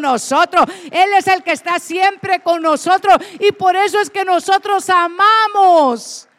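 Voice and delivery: loud, ringing preacher's proclamation; fiery sermon climax; full preaching. Acted. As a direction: proclaim the invitation to the whole church.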